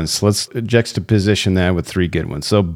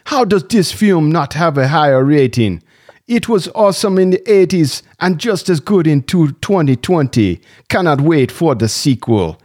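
put-on Jamaican accent; 7 semitones higher than usual. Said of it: "Why is he doing a Jamaican accent?".

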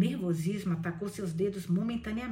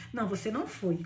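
plain reading voice

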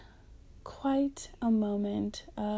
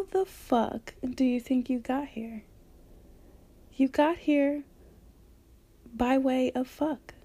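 louder and higher, hammering "You got here by way of fuck" home; loud emphatic point; 3 semitones higher, 6 dB louder than usual. Real, not acted. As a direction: punch "fuck" like a preacher making a point. Emphatic rant about sex and pleasure.

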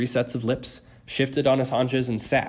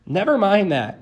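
high, louder brush-off; urgent interruption; 10 semitones higher, 5 dB louder than usual. A narrator's reading. Grover tugging his friend's shirt, urgent. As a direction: cut the memory short urgently.

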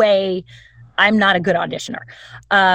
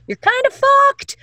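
high comic squeak; squeaky punchline; 3 dB louder than usual. Real, not acted. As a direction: squeal it for a laugh.

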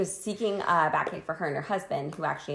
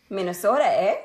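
mock Minnesota accent, laughing; a playful Minnesotan twang on the vowels; 3 semitones higher, 6 dB louder than usual. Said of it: "I do it in an accent".

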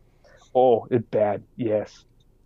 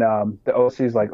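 ordinary delivery

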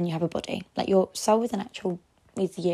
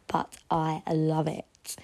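ordinary talk, nothing marked

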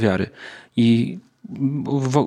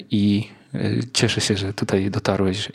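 ordinary talk

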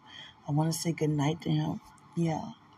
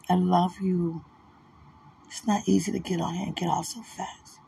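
ordinary talk

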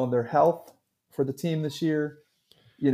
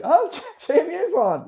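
excited quote. Retold or imitated. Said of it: "were like".

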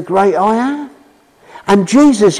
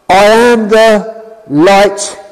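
solemn Lord quote; slower than usual. Acted. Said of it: "Then he said".

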